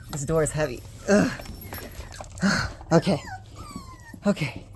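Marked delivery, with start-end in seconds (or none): 1.4-2.9 tired sigh. Real, not acted. Exhausted after herding puppies.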